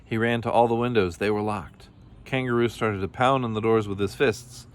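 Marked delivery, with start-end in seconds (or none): none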